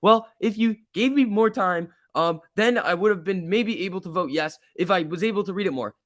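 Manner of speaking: high, whiny mocking voice; mocking Hester. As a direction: parody her excuse in a high put-on voice. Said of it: "she said was like".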